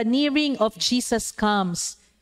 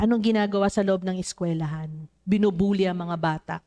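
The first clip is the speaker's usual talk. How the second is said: ordinary talk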